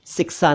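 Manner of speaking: शिक्षा said as "sik-sa"; plain s for both sh sounds in शिक्षा; mispronounced शिक्षा. Shown as a demonstration. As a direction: turn शिक्षा into सिक्सा. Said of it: "शिक्षा नहीं".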